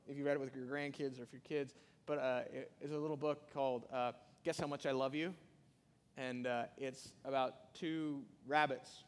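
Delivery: normal talking voice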